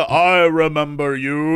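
slow, lightly voiced menacing boss; boss voice line. Friend quotes a boss line, lightly menacing. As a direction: drawl it like a villain. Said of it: "That's like the".

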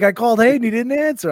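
very high, loopy dad imitation; looped up on painkillers. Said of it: "he was all looped up on pain pills".

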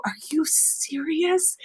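slow, hushed are you serious; a quiet, breathless question of disbelief; stunned disbelief. Retold or imitated. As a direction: barely get the words out in shock. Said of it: "I just put my hand out and I go".

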